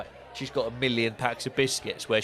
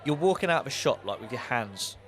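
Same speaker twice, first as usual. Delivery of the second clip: ordinary delivery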